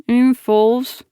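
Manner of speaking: words mushed round a mouthful of chips; mouth full of chips. Acted. Dodging a question, mouth stuffed with chips. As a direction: talk through a mouthful of chips. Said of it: "my mouth full of chips so that it comes out as".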